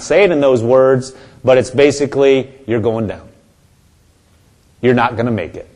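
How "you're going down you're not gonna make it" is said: low, subdued voice of doom; devil's discouraging voice. Acted. Devil telling believers they will fail, low and quiet discouragement. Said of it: "trying to say".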